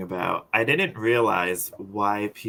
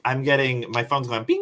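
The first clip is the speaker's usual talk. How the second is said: normal talking voice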